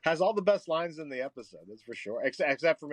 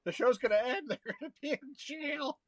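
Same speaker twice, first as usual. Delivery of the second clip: much higher, mock panicked child; a kid fretting the show will end; worried kid; 11 semitones higher than usual. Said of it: "You're like".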